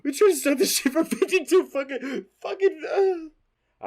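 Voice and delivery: high, laughing whine, words dragging out; laugh-crying frustration. Real, not acted. Host venting about the many failed takes, high laughing whine. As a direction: collapse into exasperated laugh-crying.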